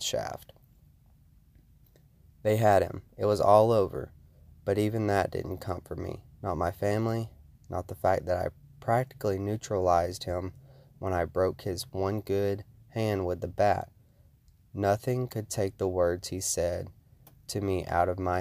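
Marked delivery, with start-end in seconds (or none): none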